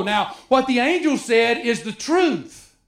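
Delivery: usual speaking voice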